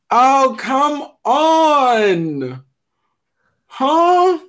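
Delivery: high, loud disbelieving cry; surprised outcry. Real, not acted. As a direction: exclaim in total disbelief. Baffled by museum entrance data.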